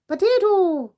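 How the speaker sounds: high, loud jokey Irish potato; mock Irish accent. Teasing Irish viewer by saying potato their way. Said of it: "as you say".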